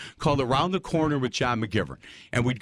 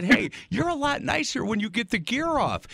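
retold teasing; 9 semitones higher than usual. Retold teasing remark to the catcher.

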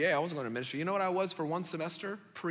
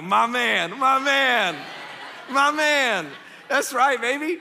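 high, loud delighted cheer; loud happy hype, laughing; excited cheer; 7 semitones higher, 8 dB louder, slower than usual. Retold or imitated. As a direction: crow it with joy at the good line. Excited reply to professor's joke.

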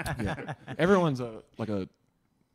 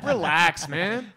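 higher, louder laid-back brush-off; mock chill; 8 semitones higher, 11 dB louder, much slower than usual. Brother brushing off the worry, loud mock-casual. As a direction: wave him off lazily. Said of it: "And it's like".

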